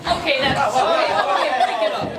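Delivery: breaking up a fight. Acted. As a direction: cut in over the scuffle.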